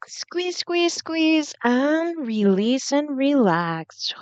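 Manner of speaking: high-pitched squeeze cues, slow stretched release; breathwork coaching. Acted. Meditation guide urging viewers to squeeze root lock, breathwork coaching. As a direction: urge the hold upward, then draw out the release.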